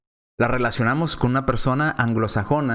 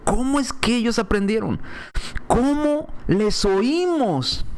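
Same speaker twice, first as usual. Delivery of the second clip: slow, drawn-out, raised amazement; astonished; 7 semitones higher, slower than usual. Acted. Preacher voicing the crowd's slow amazement. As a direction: stretch each word in wonder.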